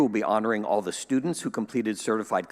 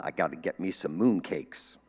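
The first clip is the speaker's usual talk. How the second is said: ordinary talk, nothing marked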